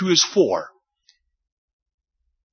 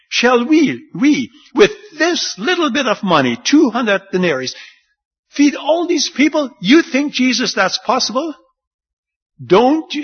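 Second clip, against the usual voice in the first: sarcastic disbelief; 8 semitones higher, 4 dB louder than usual. Retold or imitated. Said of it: "they say it with a lot of sarcasm".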